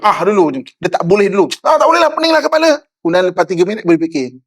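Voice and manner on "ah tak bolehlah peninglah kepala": fast, high-pitched knee-jerk refusal; mock exasperated instant refusal; spontaneous reaction. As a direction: blurt it before thinking, high and hurried. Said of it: "dia bercakap dulu, berfikir kadang-kadang".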